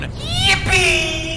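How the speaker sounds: high, loud cartoon cheer; mock yippee. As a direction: squeal it like a kid. Cheering at Flavor Town.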